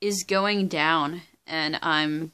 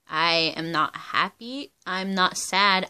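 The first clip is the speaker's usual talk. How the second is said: ordinary delivery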